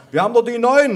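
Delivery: high, loud interjection; grandpa calling out. A narrator's reading. Grandpa answers about the smoke detectors, loud calling. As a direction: call it loudly across the room. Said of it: "rief mein Opa".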